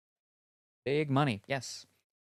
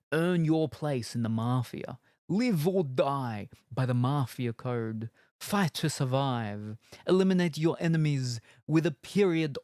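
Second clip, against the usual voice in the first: louder, weighty trailer narration; trailer voice read; 4 dB louder than usual. Acted. Reading the game box to chat, trailer voice.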